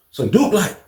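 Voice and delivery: ordinary talk, nothing marked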